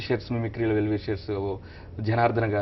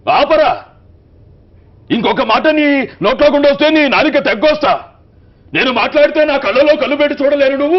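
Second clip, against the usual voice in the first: much higher, much louder furious shouting threat; Balakrishna doing Rajinikanth lines; 13 semitones higher, 11 dB louder than usual. Retold or imitated. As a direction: roar the village chief's verdict at the upstart. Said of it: "Rajinikanth గారి డైలాగులు బాలయ్య గారు చెప్తే".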